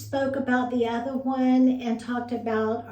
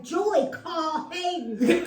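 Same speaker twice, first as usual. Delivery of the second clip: higher, drawn-out annoyed order; mock exasperation; 5 semitones higher, slower than usual. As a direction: imitate a friend crossly giving orders. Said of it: "She's mad at me".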